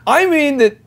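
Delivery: high, slow, caught off guard; flustered prospect. Retold or imitated. Prospect cornered by a question, high and flustered.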